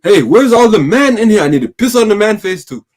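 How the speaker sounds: high, loud mock West African preacher; hammed-up Ghanaian prophet shouting; west african accent. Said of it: "your Ghanaian accent".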